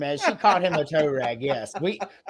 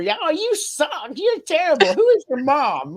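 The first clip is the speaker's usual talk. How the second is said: high, louder jeering insults; mock taunting woman; 11 semitones higher, 4 dB louder than usual. Imitating the woman insulting the robber, high loud jeering. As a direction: pile on the insults like a scornful old lady. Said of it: "roasting your skills as a robber".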